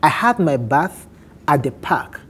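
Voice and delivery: ordinary talk, nothing marked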